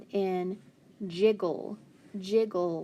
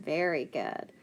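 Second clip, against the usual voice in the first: usual speaking voice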